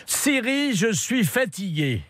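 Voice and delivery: high, deliberate call to a machine; addressing a voice assistant. Real, not acted. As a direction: speak clearly so the phone understands. Talking to the Siri voice assistant.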